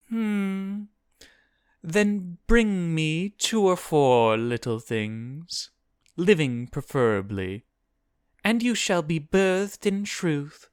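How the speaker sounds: high, drawn-out demon musing; name your price as a pondering demon, voice pitched high. A narrator's reading. Voices demon making a bargain.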